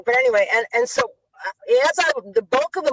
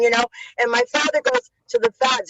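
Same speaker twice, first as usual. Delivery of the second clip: normal talking voice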